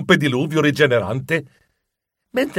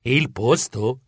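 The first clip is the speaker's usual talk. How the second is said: low, slow puzzled question; hesitant confusion; bewildered echo; 4 semitones lower, 4 dB quieter, much slower than usual. Acted. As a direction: repeat it slowly, baffled. Bewildered captive echoes the queen.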